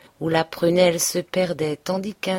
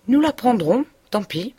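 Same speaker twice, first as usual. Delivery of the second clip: higher and louder, headlong decision; reckless resolve; 5 semitones higher, 3 dB louder than usual. Acted. Emma answering Rodolphe about her daughter, reckless resolve. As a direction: toss it off recklessly.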